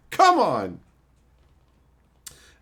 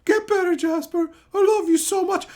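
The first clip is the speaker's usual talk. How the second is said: put-on high, breathy-free gushing girl voice, much higher; mock a swooning girlfriend; mock sappy girl; 12 semitones higher, 3 dB louder than usual. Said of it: "this chick runs back to Jasper and is like".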